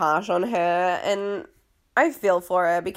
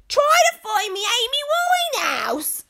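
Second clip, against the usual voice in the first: extreme London accent, much higher; 3 dB louder than usual. Shown as a demonstration. Dropping from posh into a singer's voice, extreme English accent. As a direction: overdo a loud English accent wildly. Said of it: "Oh, that was extreme".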